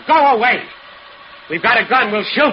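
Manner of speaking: very high and loud threat; threatening shout. Acted. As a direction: bluff them off with a yell. Husband bluffs threat.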